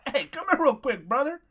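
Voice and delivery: hammed-up meathead summoning a guy; mock tough guy. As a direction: play the bully calling someone over. Mocking the singer who confronted him.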